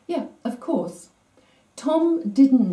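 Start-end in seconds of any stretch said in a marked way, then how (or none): none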